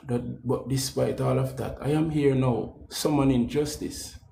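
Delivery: soft, low-voiced pep talk; soft motivational appeal; quiet sincere encouragement. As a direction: speak gently to brothers and sisters.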